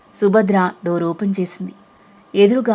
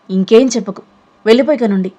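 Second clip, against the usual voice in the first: much louder furious yell; loud enraged command; angry shout; 3 semitones higher, 6 dB louder than usual. Acted. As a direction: throw her out with a shout. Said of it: "అని కోపంగా అరిచింది".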